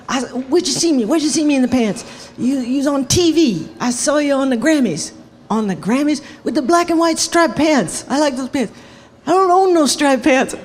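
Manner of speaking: hammed-up squabble; mock bickering. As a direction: argue like two stubborn old men. Acted bickering of two old men.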